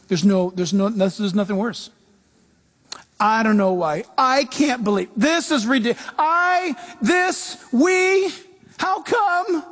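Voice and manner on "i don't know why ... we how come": rising high, sputtering whine; high indignant whine; complainer. Acted. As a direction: splutter in frustration. Voicing a complaining believer.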